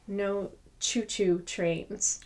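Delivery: ordinary delivery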